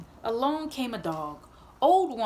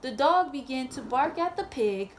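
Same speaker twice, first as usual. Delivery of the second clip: ordinary delivery, nothing marked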